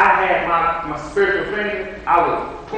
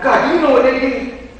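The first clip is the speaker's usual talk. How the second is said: higher, eager tattletale voice; mock outrage, pitched up; tattling to God; 8 semitones higher, 5 dB louder than usual. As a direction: tell on them to God, indignant. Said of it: "and I said".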